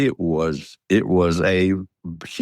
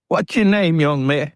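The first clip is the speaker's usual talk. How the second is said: a hint of a fairground showman hailing a young man; lightly voiced carnival barker; 8 semitones higher than usual. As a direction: call him up like a showman. Carnival bear man addressing him.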